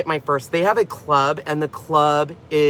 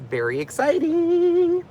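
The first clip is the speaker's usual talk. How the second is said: excited; 12 semitones higher, slower than usual. Real, not acted.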